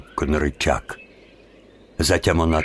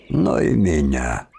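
low, slow, sly whisper; 5 semitones lower, slower than usual. Acted. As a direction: say it low and knowing. Aloud to himself, sly. (тихо, медленно, с угрозой)

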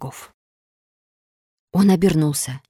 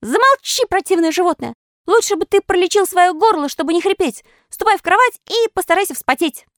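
high, louder rude puppet yell; rude shout; 14 semitones higher, 3 dB louder than usual. A narrator's reading. Pinocchio rudely shouting at the crab, high mocking yell. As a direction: snap at the crab with scorn. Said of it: "грубо крикнул ему".

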